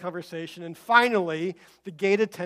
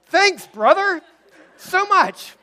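mock thanks; 12 semitones higher, 13 dB louder, much slower than usual. Forced thanks to the eager seatmate.

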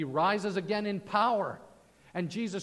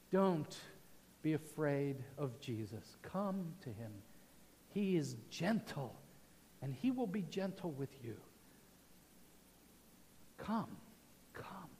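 soft invitation; 4 semitones lower, 8 dB quieter than usual. Real, not acted.

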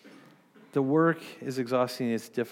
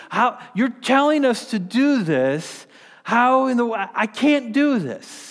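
higher, louder, mock-frantic disbelief; exasperated disciple; 6 semitones higher, 3 dB louder than usual. Disciples protest to Jesus, higher and louder in exasperation. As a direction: play a swamped disciple throwing up his hands. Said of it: "He's saying".

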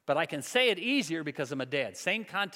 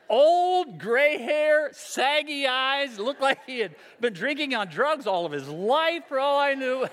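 excited laughing list; 7 semitones higher, 6 dB louder, slower than usual. Real, not acted. Describing the lookalike, laughing.

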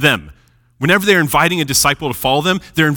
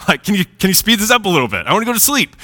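higher, faster impatient plea; impatient whining; 7 semitones higher, faster than usual. Retold or imitated. Tired fisherman nudging Jesus to finish, mock impatient whine. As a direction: hurry the preacher along, half joking. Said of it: "I always imagine Peter during that time being like".

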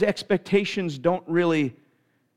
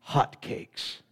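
awed whisper; 7 dB quieter than usual. Retold or imitated. Child reading magical menu words.

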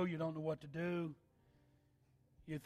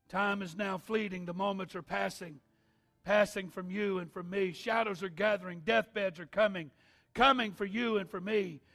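building to loud, higher, fervent preaching; preaching at full force; 7 semitones higher, 15 dB louder than usual. Acted. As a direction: rise into a roaring altar call. Preacher building altar call.

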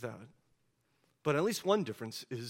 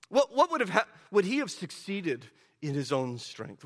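ordinary delivery

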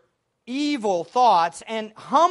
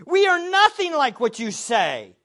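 loud, emphatic mock protest; raised voice, acting out an indignant objection; imitated outcry; 2 semitones higher, 5 dB louder than usual. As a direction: cry out in horrified objection, imitating. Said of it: "their actions screamed in effect".

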